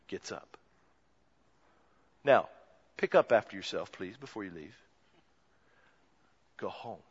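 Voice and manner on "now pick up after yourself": usual speaking voice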